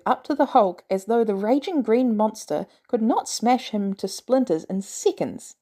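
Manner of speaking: ordinary delivery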